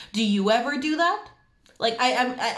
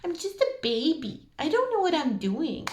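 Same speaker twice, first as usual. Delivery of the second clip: high, whiny baby voice playing dumb; mock a grown-up pleading they're just a baby; helpless baby voice; 7 semitones higher than usual. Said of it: "It's like a sound".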